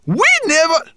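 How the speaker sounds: indignant shriek, very high and loud; high, loud cry of protest; Berber Chan. A narrator's reading. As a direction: squeal in outraged protest. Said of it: "cried Berber Chan indignantly".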